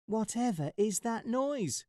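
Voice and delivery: high, wondering mouse squeak; a curious little mouse pipes up; high puzzled mouse voice; squeaky little mouse. Acted. Mouse voice in story.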